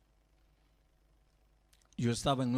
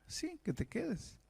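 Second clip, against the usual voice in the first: tender coaxing; 10 semitones higher, 7 dB quieter, slower than usual. Retold or imitated. Pastor gently urging the boy.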